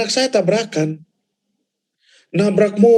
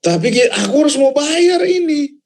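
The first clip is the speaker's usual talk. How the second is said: crying plea; 8 semitones higher, 3 dB louder than usual. Retold or imitated. Complaining to God about the debt.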